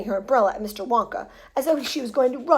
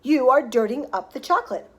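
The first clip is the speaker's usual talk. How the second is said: loud, measured scolding order; agitated cry; 3 semitones higher, 7 dB louder than usual. A narrator's reading. Wonka to the boy dirtying his chocolate, agitated cry. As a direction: order him away, loud and emphatic. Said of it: "cried Mister Wonka, hopping up and down".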